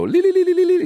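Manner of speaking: high, trilled ululation. Shown as a demonstration. Comedian calling out to Middle Easterners in the crowd, ululating. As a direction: trill a rapid high "li-li-li" call. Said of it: "my people will ululate back".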